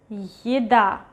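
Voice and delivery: еда with е as a short yi before a stressed a; reduced initial Ye. Shown as a demonstration. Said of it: "At the beginning of a word".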